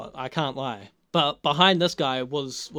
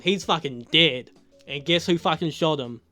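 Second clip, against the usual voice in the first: ordinary talk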